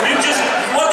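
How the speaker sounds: normal talking voice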